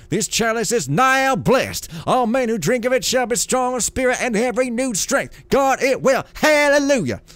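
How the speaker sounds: loud, high preacher's proclamation; booming divine lord. Acted. Builder blessing the clay chalice, booming preacher voice. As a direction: preach it up to a ringing hallelujah.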